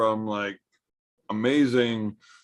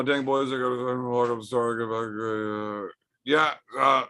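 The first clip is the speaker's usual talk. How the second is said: ordinary talk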